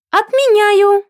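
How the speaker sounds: put-on piercing high child's shriek; shrill screeching bird woman. A narrator's reading. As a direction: screech it like a furious toddler. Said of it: "заверещала Агата. Пронзительно так заверещала". (пронзительный визг ребёнка)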